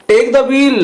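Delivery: high-pitched mock order; wife shouting to the narrator, high urgent command. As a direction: bark the command over the storm. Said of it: "जोर से बोल रहे हैं".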